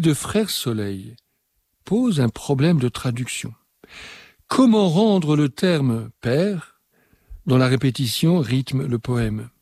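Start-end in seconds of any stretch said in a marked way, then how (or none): none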